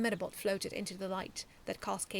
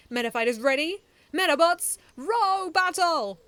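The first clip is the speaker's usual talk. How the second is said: loud, higher, drawn-out battle call; announcer start call; 8 semitones higher, 13 dB louder than usual. A narrator's reading. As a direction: kick off the fight like a ring announcer.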